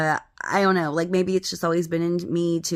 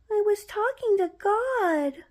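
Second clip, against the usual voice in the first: put-on very high, slow small-child confession; put-on tiny child voice, drawn out; little brother's voice; slower than usual. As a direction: confess like an innocent little boy. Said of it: "when my little brother says".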